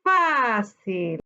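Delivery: load the voice onto the FÁ of "Fácil"; slow "Fácil", long FÁ falling to cil; stressed syllable stretched. Shown as a demonstration. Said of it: "Su sílaba tónica".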